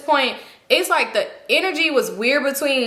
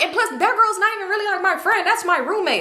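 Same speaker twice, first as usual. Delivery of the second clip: higher-pitched imitated excuse; defensive justification; 8 semitones higher than usual. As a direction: act out her dismissive self-defence, pitched up. Acted friend justifying herself.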